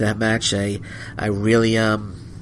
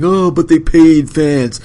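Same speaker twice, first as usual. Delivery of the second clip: slow, higher and louder mocking voice of the naysayers; mocking the critics; 5 semitones higher, 7 dB louder, slower than usual. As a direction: sneer it out like the online critics. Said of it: "everyone's saying".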